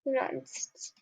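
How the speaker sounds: whispered under the breath; unvoiced frustrated murmur. Real, not acted. Frustrated muttering.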